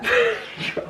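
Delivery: a short high giggle at herself; giggle, a bit embarrassed. Real, not acted. Telling viewers she hasn't showered, high giggle. Laughing at having skipped a shower.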